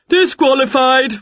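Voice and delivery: loud high announcement; firm ruling. A narrator's reading. Judge ruling Wilbur out, loud. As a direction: declare it with authority.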